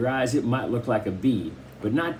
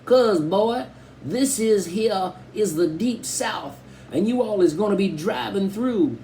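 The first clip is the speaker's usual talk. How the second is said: put-on hillbilly accent; 6 semitones higher than usual. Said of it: "Dad did an imitation of a hillbilly accent".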